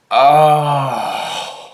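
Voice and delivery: high, loud sudden oh; dawning realisation. Retold or imitated. Seeing the expired date, a startled oh.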